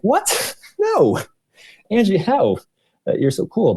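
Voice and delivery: high squeaky mock outrage, with a laugh; shocked disbelief. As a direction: play him aghast that his hero is rejected. Coworker shocked speaker dislikes Tate.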